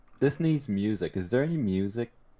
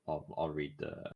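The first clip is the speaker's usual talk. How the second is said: ordinary talk, nothing marked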